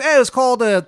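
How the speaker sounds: loud, high, exaggerated Maryland twang; terrible Maryland accent. Baba Yaga greeting a newcomer, bad Maryland accent. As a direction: holler in a hammed-up Maryland accent. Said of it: "accent is like nails on a fucking chalkboard".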